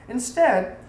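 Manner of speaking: ordinary delivery, nothing marked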